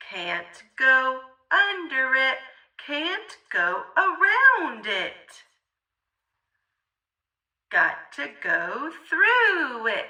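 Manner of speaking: usual speaking voice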